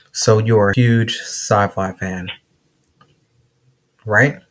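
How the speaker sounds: huge sci-fi fan spelled out slowly; slow, careful, over a bad line; slow and deliberate. Shown as a demonstration. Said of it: "Making me say this over and over again".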